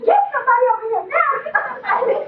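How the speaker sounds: high, loud, fast panicked plea; frantic imitated shriek down the phone; retold crying scream. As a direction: imitate a hysterical emergency caller. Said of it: "she's crying and screaming".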